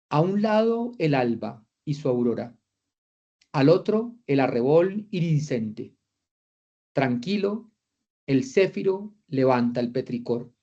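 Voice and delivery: slow, solemn reading of a flowery verse; poetic recitation. Shown as a demonstration. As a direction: recite it like grand poetry, with long pauses. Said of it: "Mírenme esta hermosa frase". (recitación poética, lenta y solemne)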